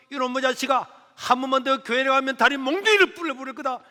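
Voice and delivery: loud, high angry scolding over dinner; mother threatening her son at dinner, loud and angry. Retold or imitated. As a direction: threaten him like a furious mother. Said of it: "막 하고 있다가".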